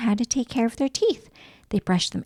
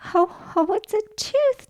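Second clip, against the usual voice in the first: laughing; 12 semitones higher, slower than usual. Acted. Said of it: "Daddy Pig laughed".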